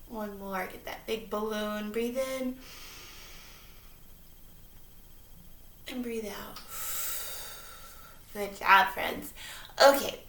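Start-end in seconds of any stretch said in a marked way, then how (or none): 0.1-6.6 calm them down gently; kindergarten teacher voice. Acted. Leads breathing exercise.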